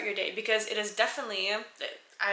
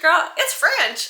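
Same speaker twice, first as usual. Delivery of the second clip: laughing retort; 7 semitones higher, 6 dB louder, slower than usual. Real, not acted.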